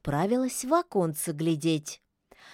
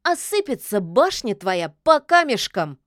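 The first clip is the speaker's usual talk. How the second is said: scornful snort; 3 semitones higher, 6 dB louder than usual. A narrator's reading. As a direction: scoff at the threat, stretching the words. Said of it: "фыркнула Яга".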